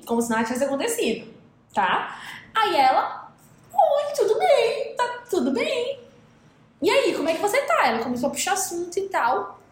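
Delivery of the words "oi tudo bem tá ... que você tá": much higher, slow singsong hello; imitating a cheery girlfriend; mock breezy greeting. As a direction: sound sweetly unbothered. Mimics girlfriend's breezy reply.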